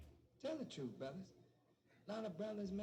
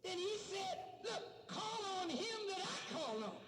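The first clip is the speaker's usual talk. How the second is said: fiery preaching; 10 semitones higher than usual. Acted. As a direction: preach it over the crowd. Preaching at full force.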